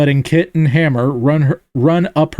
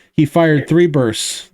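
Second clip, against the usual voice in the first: ordinary delivery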